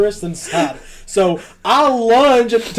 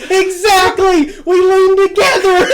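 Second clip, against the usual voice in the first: mock shout; 12 semitones higher, 8 dB louder than usual. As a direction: shout it back, dragging it out. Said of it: "And I go".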